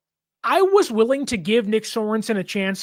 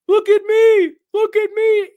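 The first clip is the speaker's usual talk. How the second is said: put-on much higher, louder childish plea; mock attention seeker; 12 semitones higher, 7 dB louder than usual. Mac begs coach Kyle to notice him, high childish whine. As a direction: whine for attention like a needy kid. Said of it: "Mac is like".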